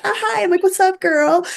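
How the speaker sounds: very high, awkward stoned hello; imitated high greeting. High festivalgoer greeting a near stranger, squeaky mock-casual voice. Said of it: "she knew that I was high".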